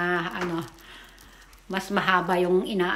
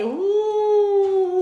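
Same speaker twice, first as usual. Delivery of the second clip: whoop; 12 semitones higher, 5 dB louder than usual. Real, not acted. Cheering at ride.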